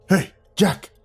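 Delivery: ordinary delivery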